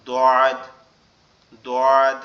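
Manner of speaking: দোয়াদ with a thick, heavy sound from the back of the tongue. Shown as a demonstration. Teacher showing the hardest letter, heavy thick demo. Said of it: "একটু ভারী মতো".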